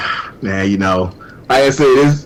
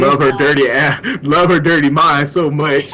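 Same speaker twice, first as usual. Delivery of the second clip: laughing; 3 semitones higher than usual. Real, not acted. Laughing while praising.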